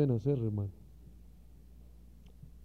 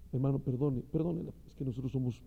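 ordinary talk

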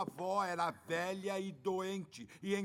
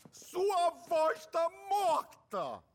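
loud, slow angry yell; angry shout; 6 semitones higher, 7 dB louder, much slower than usual. Acted. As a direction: bark it out, furious. Father yells at son.

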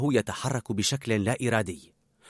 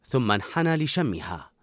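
ordinary delivery

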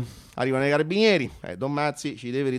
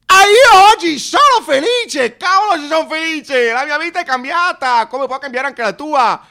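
much higher, loud, hammed-up joy; shouting joy at the door; ecstatic shout; 11 semitones higher, 9 dB louder than usual. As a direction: proclaim it like a revival convert. Ecstatic convert proclaims happiness.

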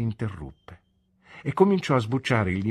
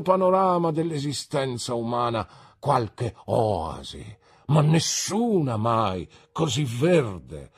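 higher, slow and pompous; grandiloquent Micawber; 8 semitones higher, 5 dB louder, slower than usual. A narrator's reading. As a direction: savour each flowery word slowly. Micawber's florid speech. (Micawber, solenne e lento)

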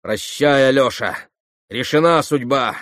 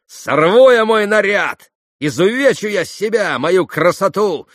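high, loud, frenzied defiance; wild outburst of threats; hysterical shouting; 7 semitones higher, 6 dB louder than usual. A narrator's reading. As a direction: rave in a raised, ringing voice. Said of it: "Последние слова она истерически прокричала".

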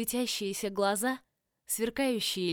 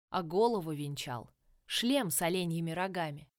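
ordinary delivery, nothing marked

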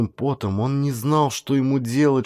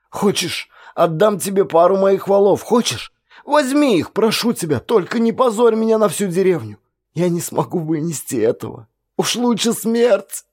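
much higher, whining plea; whimpering; 7 semitones higher than usual. A narrator's reading. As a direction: whine and beg like a child. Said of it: "захныкал". (вор хнычет, очень высоко)

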